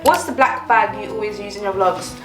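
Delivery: ordinary talk, nothing marked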